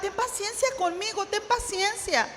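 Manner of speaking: high, soft begging, slowing at the end; desperate plea. A narrator's reading. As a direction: beg the king for mercy in a small voice. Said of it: "le suplicaba".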